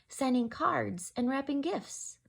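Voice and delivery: gentle rhyming read-aloud; story narration. A narrator's reading. Teacher reading to children in storytime voice. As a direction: read it like a bedtime picture book. Reads picture book aloud.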